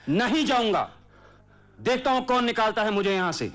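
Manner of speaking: high, loud challenge; defiant shouting. Acted. Son refusing to leave his father's house, defiant shout.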